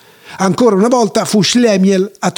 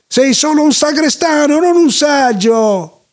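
put-down yelled in a raised pitch; indignant yelling; 7 semitones higher than usual. Acted. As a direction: bawl it out, stretching the last words. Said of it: "sbraitò Zeinvel Gne Gne".

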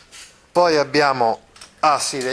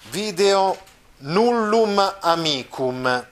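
video nullum amicum, said very slowly; slow example; 5 semitones higher, much slower than usual. Shown as a demonstration. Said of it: "quindi per esempio". (esempio detto molto lentamente)

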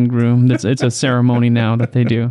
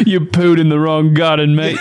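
higher-pitched mock Australian accent; 4 semitones higher than usual. Woman confronting the driver in her garden, mock Australian. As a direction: put on a jokey Australian threat. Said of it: "Now she's Australian".

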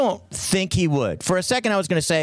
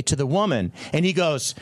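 ordinary talk, nothing marked

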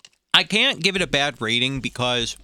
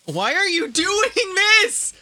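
disgusted whine. Real, not acted. Forced to eat stale cereal.

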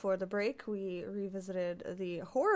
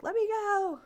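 high, louder mock yell; imitated yell; 13 semitones higher, 5 dB louder than usual. Host voicing the restrained woman, imitated yell. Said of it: "yelling the entire time".

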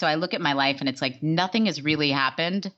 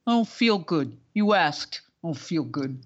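put-on odd accent, grumpy; Scott Baio; slower than usual. Imitating his weird answer to a greeting, mock impression. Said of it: "I wonder if he was putting on an accent".

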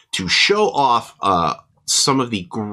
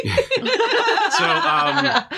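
laughing. Real, not acted. Laughing after snacks joke.

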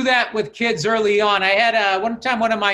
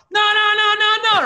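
high, louder, sing-song no no no; mock frantic warning; 13 semitones higher, 4 dB louder than usual. Cab driver warning the passenger not to pay the hustler, high frantic chant.

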